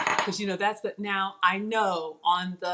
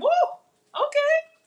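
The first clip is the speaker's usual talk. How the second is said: high, rushed squeal of surprise; surprised reaction; 5 dB louder, slower than usual. Real, not acted. Cook reacting to vinegar in sauce, high and startled. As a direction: shoot the pitch way up at the tang.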